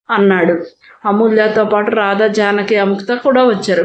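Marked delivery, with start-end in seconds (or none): none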